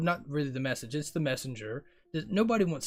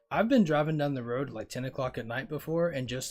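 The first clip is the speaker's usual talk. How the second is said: normal talking voice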